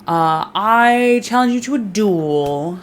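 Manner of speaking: mock-furious call to a duel; mock challenge. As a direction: drag out the insult like an old-time dueller. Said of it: "and he's like".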